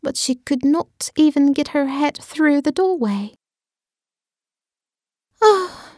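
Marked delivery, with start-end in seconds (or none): 5.4-5.9 surprised. Acted. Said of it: "said Alice".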